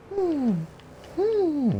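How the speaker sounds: put-on very high, breathy young heroine; high girl voice. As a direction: lift into a high girlish falsetto. Mulan's voice.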